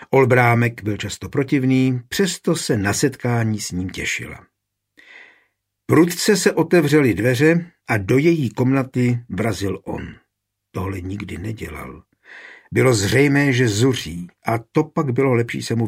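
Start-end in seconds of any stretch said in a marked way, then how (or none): none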